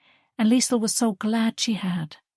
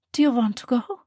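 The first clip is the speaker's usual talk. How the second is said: gentle mother; 6 semitones higher, 3 dB louder than usual. Acted. Mother coaxing her daughter, lightly voiced and gentle.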